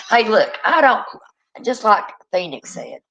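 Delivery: ordinary talk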